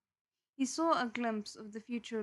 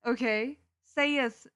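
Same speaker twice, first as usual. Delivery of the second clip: stifling a laugh; 2 semitones higher, 9 dB louder, slower than usual. Real, not acted. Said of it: "I can't even say that with a straight face".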